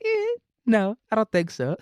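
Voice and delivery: high, mocking sing-song no; smug refusal. Papua New Guinea turning down Bougainville, mocking and smug. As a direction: toy with them, then shut them down.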